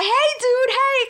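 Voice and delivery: very high cheery hail; calling out. Retold or imitated. Out the window to the neighbor's husband, very high cheery greeting. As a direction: sing out a greeting to gather witnesses.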